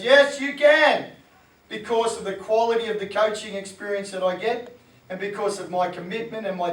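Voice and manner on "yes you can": slow, high, loud self-belief; mock inner cheerleader, drawn out and high; confident inner voice. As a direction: say it like the voice that knows you can. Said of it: "And the voice goes".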